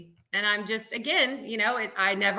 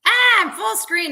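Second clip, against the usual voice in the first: a high, loud yelp of surprise; startled; 10 semitones higher, 6 dB louder than usual. Real, not acted. Guest reacting to the host putting her on full screen, startled and high. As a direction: caught off guard by suddenly filling the screen.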